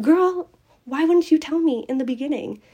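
playfully fed up, pushing back at the tutor; a friend protesting she wasn't warned sooner, exasperated. Retold or imitated. Exasperated retort to friend's teaching.

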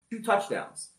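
ordinary talk, nothing marked

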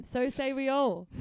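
mock Australian chant, loud, high and slow; Australian accent. Imitating Kendra over the shouting crowd, jarring Australian accent. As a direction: belt it out in a broad Australian accent. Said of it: "with a really Australian accent".

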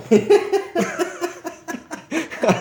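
big squealing laugh; high burst of laughter. Real, not acted. Telling a friend about the photo, bursting out laughing. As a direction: crack up at the memory.

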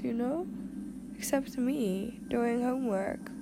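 ordinary talk

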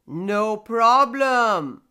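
loud, slow, booming storybook elder; big resonant voice of a talking stone; grandfather stone. A narrator's reading. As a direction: boom it out generously, stretching the words. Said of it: "said the grandfather stone".

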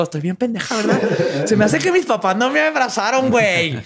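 put-on high, laughing valley-girl voice; ditzy girl voice. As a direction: play a girl realizing her mistake, giggling. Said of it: "llegó un punto en donde dijo".

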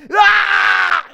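high, loud sustained shriek; imitated intense scream. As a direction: let out an escalating eerie scream. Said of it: "it gets more intense, and it's like".

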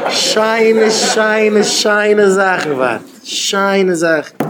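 high, loud, long-drawn words; mock-excited exclamation. Mimicking the promise of nice things. (הויך און אויסגעצויגן נאכגעמאכט)

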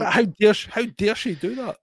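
mock outrage. Cohost mocking the idea of nan going out, hammed-up outrage. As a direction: ham up the fake shock.

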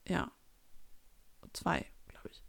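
a quick private whisper, half to yourself; whispered aside. Real, not acted. Unsure about Ronja's age, she whispers an aside. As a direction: whisper it under your breath, toneless.

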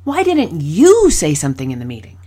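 "you" hit loud and pointed; single out the listener by punching "you"; pointed YOU in the question; stress on YOU. Shown as a demonstration. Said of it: "six different ways I said the same question".

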